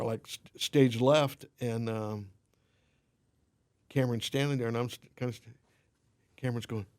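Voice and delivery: normal talking voice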